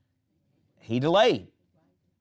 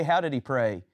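ordinary delivery

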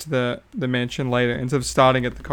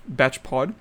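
ordinary talk, nothing marked

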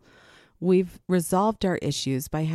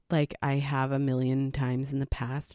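usual speaking voice

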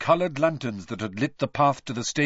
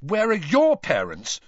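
higher, louder angry demand; Harry snapping at Malfoy, higher and louder in anger; temper rising; 7 semitones higher, 4 dB louder than usual. A narrator's reading. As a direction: let anger climb in it. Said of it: "his temper rising".

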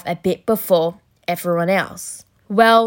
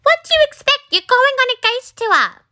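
much higher, exasperated mum voice; squeaky, unimpressed mother; Mum's voice. Retold or imitated. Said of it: "and she said".

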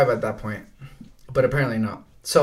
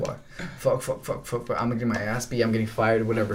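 panicked muttering; 4 semitones lower, 7 dB quieter than usual. Retold or imitated. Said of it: "I was in shock".

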